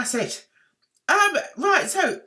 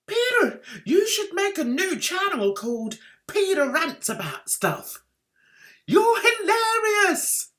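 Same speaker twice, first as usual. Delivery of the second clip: hammed-up fan comment; 7 semitones higher, 5 dB louder than usual. Reading a fan's comment aloud.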